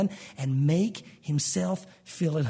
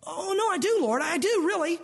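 much higher, pleading reassurance; eager protest; 10 semitones higher than usual. Acted. Believer protesting to the Lord in a high eager voice. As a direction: insist too eagerly, voice up high.

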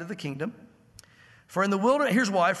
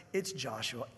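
God's quiet voice; 7 semitones lower, 10 dB quieter, slower than usual. Acted. God names the successor.

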